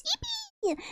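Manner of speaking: high soft squeak; squeal. Real, not acted. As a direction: squeak it out happily. Squealing thanks for resub.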